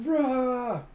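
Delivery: high, loud "rah"; mock rallying cry; crowd cheer. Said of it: "they're cheering".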